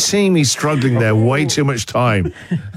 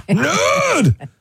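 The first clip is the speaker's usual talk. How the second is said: high squeaky yelp; squeaky nerd taunt. Pretend. Mock insult, high yelp.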